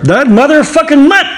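high pitched outburst; shrill mock caller yell. Caller ranting about the player, high mock yell. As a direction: squeal it like an outraged fan.

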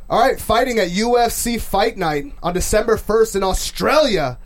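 big ring-announcer intro, building to a shouted name; slow build into a loud, high, stretched-out name; fight announcer. Shown as a demonstration. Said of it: "listen to this".

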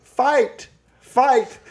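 high-pitched imitation of a schoolyard chant; playground fight call. Someone on the playground during a fight, calling out high. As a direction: mimic a kid calling a fight. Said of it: "someone on the playground going".

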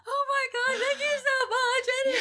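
high, slow crying thanks; tearful relief. Retold or imitated. Thanking the boss who promised safety, crying with relief. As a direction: break into grateful tears.

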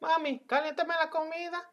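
high, soft mock kid voice; pleading child. Child asking mother during her novela, mock pleading. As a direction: imitate a kid asking mom for something.

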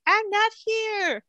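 a lightly voiced child mumbling he is absent; imitating a sulky kid.

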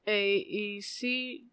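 alphabet through still lips; soft ventriloquist mumble; without moving mouth. Shown as a demonstration. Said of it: "sing the alphabet without moving your mouth".

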